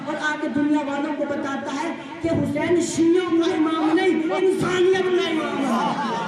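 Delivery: building to a high, loud rousing climax; fiery preaching. Acted. Preacher rousing the whole gathering, loud and high. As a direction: preach it, each line rising in force.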